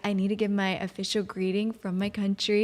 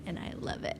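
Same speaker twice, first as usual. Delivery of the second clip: whispered, much softer aside; 14 dB quieter than usual. Real, not acted. Telling her husband she loves married life, whispered. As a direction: breathe it to him like a secret. Tender aside about marrying an American.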